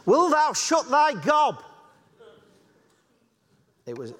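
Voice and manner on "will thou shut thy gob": put-on higher, slow broad Yorkshire childhood taunt; old Yorkshire kids' thee-and-thou taunt, played up; Yorkshire kid's cheeky playground shout; Yorkshire dialect. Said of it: "what we used to say in Yorkshire as kids".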